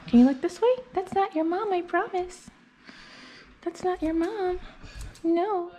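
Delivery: high, sing-song voice to a dog; pet talk. Real, not acted. Groomer soothing the nervous dog in high pet talk.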